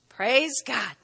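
usual speaking voice

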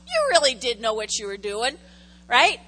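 ordinary delivery

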